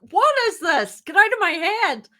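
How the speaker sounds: playfully spooked that she reads your mind; mock disbelief. Speaker spooked by the co-founder turning up everywhere, mock alarm. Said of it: "It's like".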